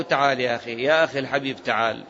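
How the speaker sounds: gentle invitation. Pretend. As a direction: coax him kindly, like calling a loved one home. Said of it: "هذا نقول له".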